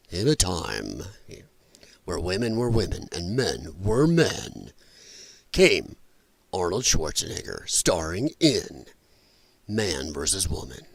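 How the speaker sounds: low, booming trailer narrator; deep, dramatic trailer announcer; movie trailer voice. Shown as a demonstration. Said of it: "the movie trailer guy voice".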